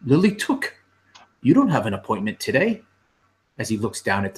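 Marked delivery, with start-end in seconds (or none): 0.1-2.8 surprised fussy clerk, quick; a prim little clerk caught off guard; lightly voiced fussy clerk; fussy clerk Dudo. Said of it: "and he says".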